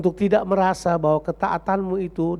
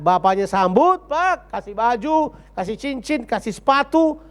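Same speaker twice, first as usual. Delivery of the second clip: rising, louder festive list, building to a high peak; rousing preaching; 6 semitones higher, 4 dB louder than usual. Acted. Preacher to congregation, excitedly listing the welcome. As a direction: preach it up, piling on the joy.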